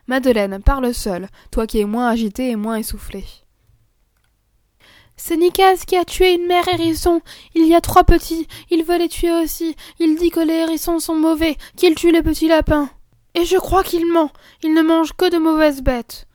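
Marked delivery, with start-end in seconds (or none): 5.2-16.3 put-on high, urgent child explaining; earnest little girl. Madeleine explaining to her mother, earnest and high. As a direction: plead the case to mother.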